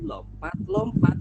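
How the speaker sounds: high, slow, hopping lilt; bouncy singsong. A narrator's reading. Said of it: "Sambil apa tadi?". (lompat-lompat dengan nada naik riang)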